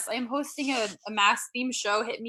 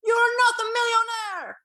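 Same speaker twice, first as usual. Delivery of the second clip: put-on high, loud, slow accented yell; dad yelling at daughter in Popeyes; imitating dad's shout; 13 semitones higher, 8 dB louder, slower than usual. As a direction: bellow it like a Romanian dad. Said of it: "randomly shouting at me".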